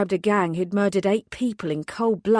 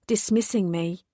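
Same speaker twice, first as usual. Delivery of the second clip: ordinary delivery, nothing marked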